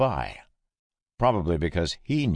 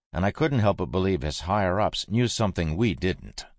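usual speaking voice

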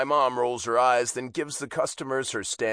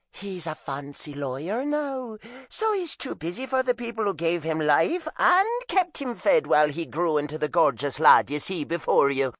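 high Irish mother bragging; proud Irish mom; Irish accent; 7 semitones higher than usual. A narrator's reading. Mom's voice in story.

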